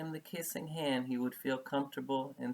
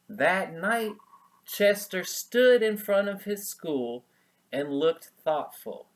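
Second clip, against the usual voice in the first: higher, louder storyteller voice; story narration; 7 semitones higher, 8 dB louder than usual. A narrator's reading. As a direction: announce the scene to children.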